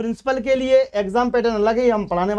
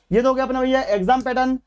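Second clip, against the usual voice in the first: ordinary talk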